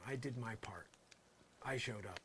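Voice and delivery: usual speaking voice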